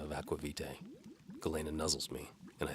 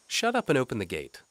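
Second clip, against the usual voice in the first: higher, louder lightly voiced young woman; Galena's voice; 8 semitones higher, 7 dB louder than usual. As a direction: voice the maid brightly and politely. Galena orders guard.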